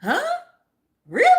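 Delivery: rising, playacted disbelief; a hammed-up huh and really, pitch climbing; fake surprise. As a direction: pretend you never heard a thing. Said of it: "we're gonna act surprised".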